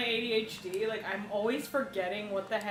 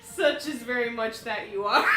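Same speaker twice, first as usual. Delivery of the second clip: laughing, louder repeat; 7 dB louder than usual. Shown as a demonstration. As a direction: shout it while laughing. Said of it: "What she literally just said".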